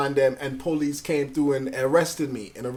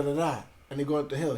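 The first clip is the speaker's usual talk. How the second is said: usual speaking voice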